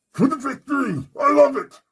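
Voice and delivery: high, loud exultant cry; excited shout. Acted. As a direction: shout it out in triumph. Exultant outburst after story.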